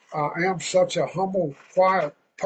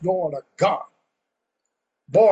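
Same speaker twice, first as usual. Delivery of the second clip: ordinary talk, nothing marked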